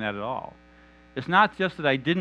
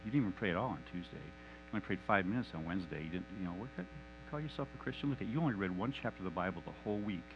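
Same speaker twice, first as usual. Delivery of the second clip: much softer, lower, insinuating murmur in the ear; whispering accuser; 5 semitones lower, 10 dB quieter than usual. Acted. Satan whispering accusations into Mike's ear, soft and lower. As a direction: hiss the guilt quietly into his ear. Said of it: "Whispering in Mike's ear".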